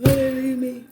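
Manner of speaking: high, loud playful lament; high mock wail. Mock lament about kids.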